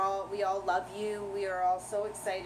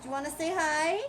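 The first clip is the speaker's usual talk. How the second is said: higher, coaxing pet voice; pet talk; 7 semitones higher, 4 dB louder than usual. Real, not acted. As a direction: coax a cranky pet to greet. Showing the cranky pet to camera.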